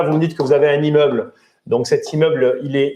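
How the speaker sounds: ordinary talk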